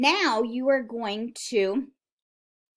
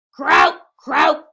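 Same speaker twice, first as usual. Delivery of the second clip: very loud, raised croaks; loud frog croak; 6 semitones higher, 13 dB louder than usual. Acted. Teacher reads the noisy frog to children, loud croaking. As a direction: croak it out at full volume. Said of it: "went the frog".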